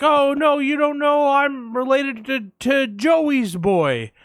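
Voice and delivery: high, loud, insistent explaining, dragging out the end slowly; mock eager claim to be kin; overfamiliar stranger. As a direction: insist on the family link. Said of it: "And they're like".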